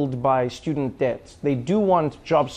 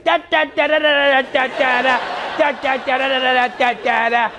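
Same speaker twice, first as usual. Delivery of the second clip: much higher, loud, rapid-fire babble; hyper Trump; 9 semitones higher, 3 dB louder than usual. Retold or imitated. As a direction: rattle on without ever stopping. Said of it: "Trump is the only one who's still like".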